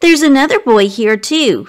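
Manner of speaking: ordinary delivery, nothing marked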